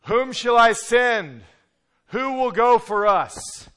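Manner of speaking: slow, loud, booming divine call; voice of God calling. Acted. God calling for someone to send, slow and booming. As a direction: call out slowly like God seeking a volunteer. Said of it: "when God says".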